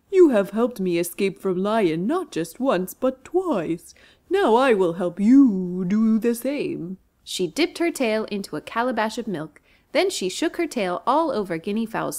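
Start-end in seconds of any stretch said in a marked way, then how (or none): 0.1-7.0 louder, slow cow voice; a kind cow thanking her friend; grateful cow. Acted. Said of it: "mooed Cow gratefully".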